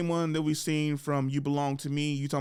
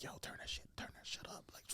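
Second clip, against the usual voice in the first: much softer whispered mock crew; joking whisper; 20 dB quieter than usual. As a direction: whisper it like a sound guy on set. Sound crew whispering to each other.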